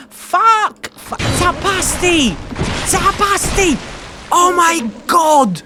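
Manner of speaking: furious yell. Real, not acted. Dying in game, yelling at friend.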